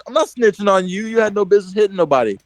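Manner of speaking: high-pitched, quick retold protest; acted-out offended retort, rushing at the end; indignant comeback. Kid answering his classmate's snitching charge, acted indignant.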